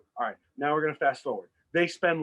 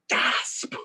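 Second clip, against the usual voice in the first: a sharp breathy gasp of shock; mock gasp. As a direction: gasp as if discovering a theft. Retelling a shocked discovery.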